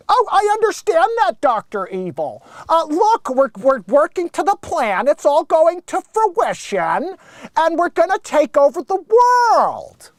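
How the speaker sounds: high, loud eager nerd voice; Bill Gates impression. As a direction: reassure the boss nervously like Bill Gates. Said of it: "Dead on Bill Gates".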